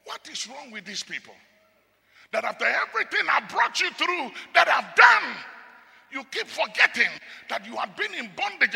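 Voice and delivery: preacher voicing God's complaint; God rebuking his people. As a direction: speak as God scolding ungrateful people. God voiced in sermon.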